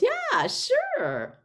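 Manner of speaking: very high, squeaky put-on voice; agreeable earthling. Earthling about to speak for the ET, squeaky agreement. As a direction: leap up high for a cartoonish yes.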